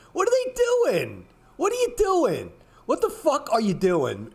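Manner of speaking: high, stretched cry of disbelief; incredulous exasperation. Real, not acted. Ranting at traders about their cluttered charts, high and incredulous. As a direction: throw your voice up in disbelief at the mess.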